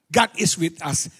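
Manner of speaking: God is with us, slow and firm on is; stress on is. Shown as a demonstration. Preacher teaching tenses, stressing is. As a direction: press on is in God is with us. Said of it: "Now, sekarang".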